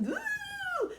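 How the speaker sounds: very high hooting squeal; a high panicked woo; hammed-up children screaming; kids' scream. A narrator's reading. Kids scream.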